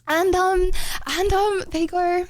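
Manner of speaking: normal talking voice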